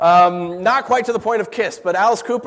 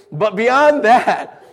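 ordinary talk

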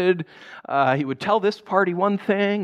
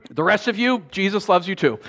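ordinary talk, nothing marked

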